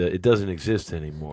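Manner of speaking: normal talking voice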